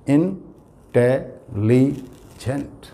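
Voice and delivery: Intelligent drawn out very slowly, four syllables apart; slow teacher's intelligent, syllables pulled apart. Shown as a demonstration. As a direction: stretch intelligent so each syllable is counted. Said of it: "चार syllable हैं".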